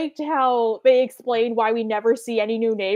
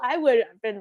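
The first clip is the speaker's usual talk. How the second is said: usual speaking voice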